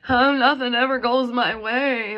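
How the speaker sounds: mock sulky victim moan, slowed down; woe-is-me victim. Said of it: "The woe is me".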